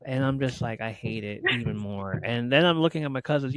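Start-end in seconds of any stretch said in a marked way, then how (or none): none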